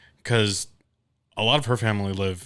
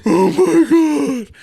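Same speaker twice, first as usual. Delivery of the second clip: much higher, louder and very slow wailing; mock ugly cry; 13 dB louder, much slower than usual. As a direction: drag it out like an ugly sob, hammed up. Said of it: "ugly cry".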